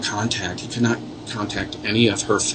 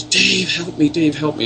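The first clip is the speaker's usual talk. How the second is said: higher, plaintive call for help; pleading ghost girl; 6 semitones higher than usual. Retold or imitated. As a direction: echo a frightened girl's plea.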